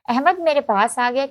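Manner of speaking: ordinary delivery, nothing marked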